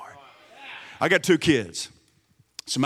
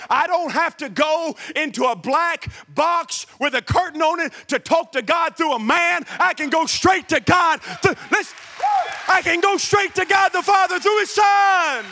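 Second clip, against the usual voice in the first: high, loud and slow, driving each claim home; preaching at full force; 8 semitones higher, 3 dB louder than usual. Acted. Pastor to his congregation, loud slow preaching. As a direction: preach it straight to the back row.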